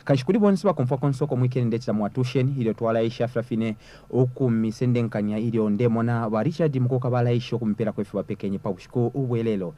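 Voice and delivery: normal talking voice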